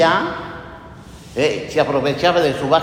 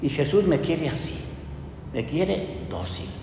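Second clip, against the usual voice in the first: ordinary talk, nothing marked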